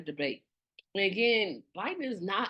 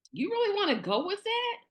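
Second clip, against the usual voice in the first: disbelieving high question; can you believe this; incredulous; 11 semitones higher than usual. Real, not acted. Incredulous at bad answer.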